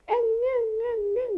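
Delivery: put-on high whiny baby crying sounds; father imitating daughter's night crying; imitated baby whimper. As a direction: whimper like a toddler. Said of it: "이러고 있는 거예요".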